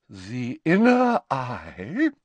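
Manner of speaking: slow, lofty and wounded dignity; dignified. A narrator's reading. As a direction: speak down from on high, slowly. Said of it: "with dignity".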